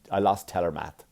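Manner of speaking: usual speaking voice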